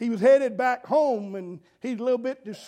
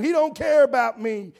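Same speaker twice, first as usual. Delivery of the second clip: loud, higher hurt grumble; mock angry pout; 3 semitones higher, 7 dB louder than usual. Preacher voicing the upset teacher, mock indignant. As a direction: whine it like a wronged teacher. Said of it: "she just kept on".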